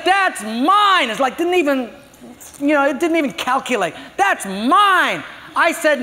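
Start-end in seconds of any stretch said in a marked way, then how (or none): 0.0-1.1 put-on high, loud little girl's claim; child's protest. Granddaughter guarding her McNuggets from Papa, high indignant claim. 4.2-5.2 put-on high, loud little girl's claim; child's protest. Granddaughter guarding her McNuggets from Papa, high indignant claim.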